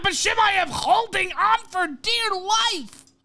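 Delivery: shouted, high fight commentary; live play-by-play. Acted. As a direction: call the takedowns at full volume. Excited live fight call.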